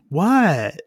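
put-on high, loud teenage "who, me?"; thirteen-year-old's response. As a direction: imitate a teen playing innocent. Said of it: "that typical thirteen-year-old response".

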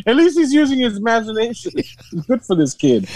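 high, patronizing praise; hammed-up high-voiced approval; mock encouragement. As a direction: praise him like an indulgent onlooker, pitched high. Mock onlookers praising the odd kid.

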